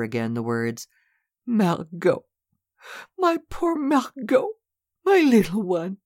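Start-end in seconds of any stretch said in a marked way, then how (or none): 1.5-6.0 high, slow tearful calling; weeping. Acted. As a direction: cry her sister's name, grieving. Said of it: "wept bitterly".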